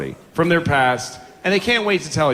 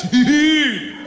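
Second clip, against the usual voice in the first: whiny dude; 11 semitones higher than usual. Retold or imitated. Friend pleading with emphasis.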